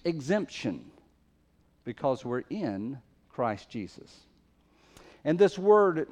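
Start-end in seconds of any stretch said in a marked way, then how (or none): none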